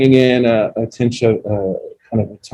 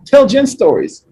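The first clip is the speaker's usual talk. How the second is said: students begging; 12 semitones higher, 4 dB louder, slower than usual. Retold or imitated. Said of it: "while, like".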